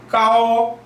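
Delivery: 까오 held high and level; first tone. Shown as a demonstration. Teacher reading gao in a high level first tone. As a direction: say 까오 high and flat like a Mandarin first tone. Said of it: "G의 음가도 영어랑 똑같습니다".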